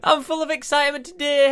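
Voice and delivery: overexcited opening line; hyped intro. Acted. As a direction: burst in like an over-the-top host. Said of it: "What a weird intro".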